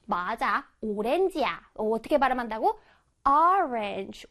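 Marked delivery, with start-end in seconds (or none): 3.3-4.3 Orange with an open 어, not 오; open first vowel. Shown as a demonstration. Teacher correcting Korean 오렌지, open 어 in Orange. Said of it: "오가 아니라 어 발음을".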